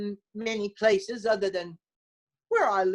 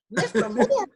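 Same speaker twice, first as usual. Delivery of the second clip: puzzled surprise; 11 semitones higher, 4 dB louder than usual. Retold or imitated. Puzzled she can't remember him.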